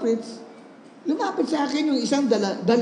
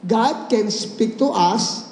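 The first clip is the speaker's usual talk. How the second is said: slow solemn opening; 4 semitones lower, much slower than usual. Acted. Pastor opens slow sermon.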